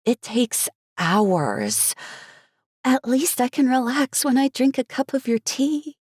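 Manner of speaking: slow, high, dramatic sigh; drawn-out bubbly complaint; Cherry's voice. Acted.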